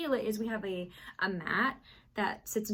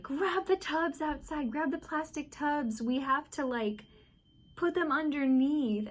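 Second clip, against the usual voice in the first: urgent orders; 6 semitones higher than usual. Retold or imitated. Telling husband to fetch tubs, higher urgent command. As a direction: bark out orders as the water pours in.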